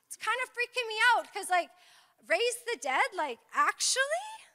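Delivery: high-pitched, incredulous questioning; lightly acted disbelief, rising on "actually"; mock freaked-out listener. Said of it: "And you're like".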